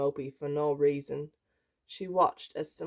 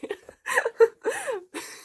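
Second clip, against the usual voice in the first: high, loud laughing fit; squealing laughter; cracking up; 5 dB louder than usual. Real, not acted. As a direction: burst out in helpless laughter. Cracks up after the story.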